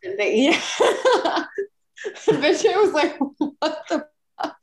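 helpless giggles through the quote; laughing while talking. Real, not acted. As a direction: crack up while retelling the punchline. Cracks up retelling friend's reaction.

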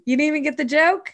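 high, fast disbelieving question; incredulous. Real, not acted. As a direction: sound shocked she missed it. Mock disbelief at cohost.

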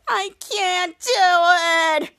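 high, drawn-out fake sobbing; mock crying wail. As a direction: wail it slowly in hammed-up tears. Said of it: "about to cry".

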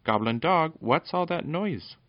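high, sweet princess voice; sweet girlish cry. A narrator's reading. Fenella calls to the dog from out of sight, sweet cry. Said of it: "a sweet, soft voice cried".

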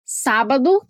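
ordinary delivery

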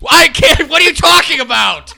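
high, loud shout of disbelief; shouted, incredulous exasperation; exasperated outburst. Real, not acted. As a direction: yell it at the end of your tether. Exasperated at monkey-tail tangent.

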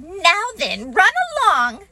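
high, motherly Kanga; Kanga's voice. A narrator's reading. Kanga sending Roo off to play, high. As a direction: shoo the little ones off kindly. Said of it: "And Kanga said".

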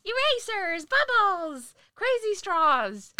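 high, loud, drawn-out pitch; excited announcer. Retold or imitated. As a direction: sell it like a giddy ad voice. Mimicking dollar section sales pitch.

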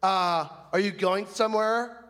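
snippy, catty clerk voice; bitchy queen. Retold or imitated. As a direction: sneer it like a catty usher. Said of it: "this bitchy queen says".

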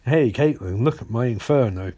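low, smooth mock flirting; chat-up line. [name] tries to chat Caitlin up, mock flirtatious. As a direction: drop the pitch for a hammy come-on. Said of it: "He does try and chat her up".

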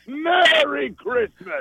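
high, slow, stretched-out greeting; campy Christmas-ghoul flourish; spooky Santa voice. Pretend. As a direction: draw it out like a hammed-up haunted Santa. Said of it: "keep that voice for Christmas".

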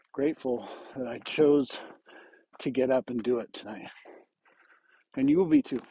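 normal talking voice

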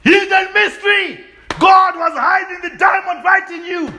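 hallelujah-style exclaim; preaching shout. Real, not acted. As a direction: cry it out to the congregation.

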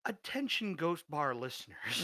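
announcer voice. Pretend. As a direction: announce it like a radio ad. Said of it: "That sounded so good".

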